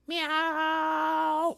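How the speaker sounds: long high drawn-out meow; cat meow. Acted. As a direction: stretch the meow like a lazy kitty. Kitty answers again.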